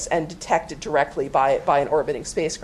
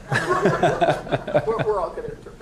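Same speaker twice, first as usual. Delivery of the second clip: laughing. Real, not acted. Laughing at joke about interpreting.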